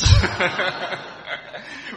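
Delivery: high, loud burst of laughter; big real laugh. Real, not acted. Chaplain cracking up at his own story before the group, loud high laugh. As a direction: let the laugh burst out after the punchline.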